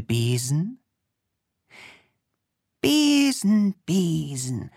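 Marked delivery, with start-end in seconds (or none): 2.8-3.7 high called spell word; calling witch. Acted. The witch begins her broom spell, calling high.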